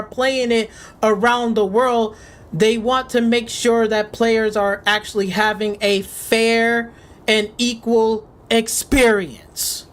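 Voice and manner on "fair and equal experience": each word slowed and pressed; hammering home the point, slowly; slow emphasis. Real, not acted. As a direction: spell it out word by word.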